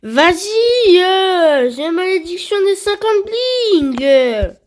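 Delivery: high, loud thrilled cry; excited shout. Real, not acted. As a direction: yell with gaming hype. Mythic drop in game.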